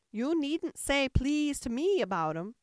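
high, loud rebuff; grumpy Sheep. A narrator's reading. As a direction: brush her off as the cross Sheep.

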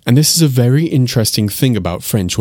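ordinary talk

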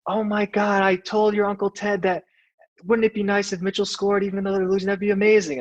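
high-pitched, gushing mom voice; imitated excited mom. Mom reacting to her son's first goal, imitated high excited voice. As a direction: pitch it way up, like a thrilled mom on the phone. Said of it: "My mom was like".

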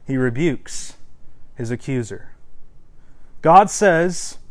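normal talking voice

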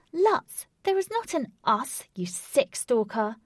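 high, loud angry retort; snapped. A narrator's reading. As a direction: snap back at the stalker, disgusted. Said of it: "Katy snapped".

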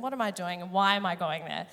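ordinary talk, nothing marked

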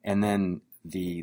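ordinary talk

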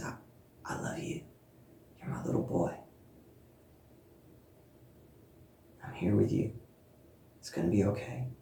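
very soft whisper of comfort; hushed whisper. Retold or imitated. Speaker voicing God reassuring her, in a hushed whisper. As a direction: barely breathe it, tender and close.

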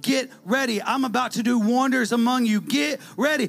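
preaching at full force. Acted. Preacher to congregation, shouting with rising fervor. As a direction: lift the room with each line.

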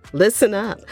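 ordinary delivery

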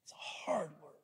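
sober near-whisper; grave whispered aside; hushed. Real, not acted. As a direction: say it very softly, almost without voice. Sober aside after harsh verse.

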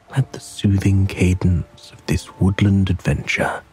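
usual speaking voice

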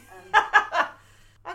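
high loud laughter. Real, not acted. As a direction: laugh hard. Laughing at the joke.

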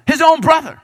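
incredulous exclamation. Real, not acted. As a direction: cry out in disbelief at the betrayal. Shocked at brother's betrayal.

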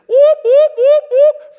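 high loud siren whoop; siren imitation. Pastor imitating the fire truck arriving, loud high siren whoops. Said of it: "all of a sudden".

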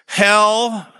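"Hell" stretched toward two syllables, Texan drawl, pitched high; Texas hell. Shown as a demonstration. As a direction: give "Hell" a Texas drawl, nearly "He-ell". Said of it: "I almost got two syllables out of that".

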